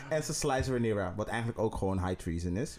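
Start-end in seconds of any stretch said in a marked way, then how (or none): none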